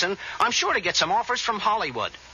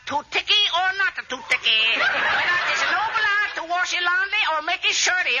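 put-on high, caricatured Chinese accent; mock Chinese accent; 10 semitones higher than usual. Would-be actor practices Chinese detective part, high mock Chinese accent. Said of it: "Gotta start practicing my new part".

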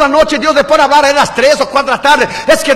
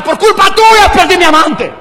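higher-pitched mock accusation; accusing letter writer; 7 semitones higher, 4 dB louder, slower than usual. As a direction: blame someone, voice pitched up, mimicking. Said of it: "La mujer me escribió una carta".

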